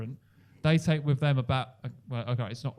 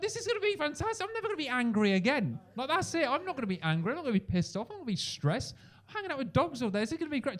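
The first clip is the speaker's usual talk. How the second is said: high, gushing delight; bubbling over with joy; giddy optimism; 10 semitones higher than usual. Retold or imitated. New dog daycare owner dreaming aloud, high and giddy. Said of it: "I was like".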